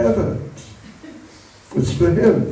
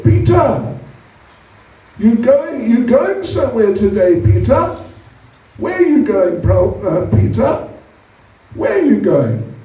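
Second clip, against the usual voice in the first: put-on high, eager little boy voice pestering his father; little Krishna; 5 semitones higher than usual. Little Krishna asks his father where he's going, high eager child voice. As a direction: pipe up high like a curious small child. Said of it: "and Krishna said".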